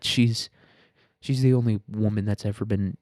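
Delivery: ordinary talk, nothing marked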